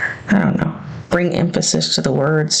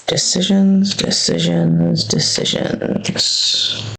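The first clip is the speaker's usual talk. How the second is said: drawn-out musing; slower than usual. Acted. Planner vlogger mulling layout, fading to a whisper. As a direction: mull it over aloud, trailing off.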